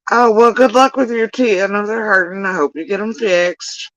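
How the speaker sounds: high, put-on well-wishing; feigned sympathy. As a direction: offer sugary concern you don't quite mean. Feigned well-wishing to someone maneuvering them.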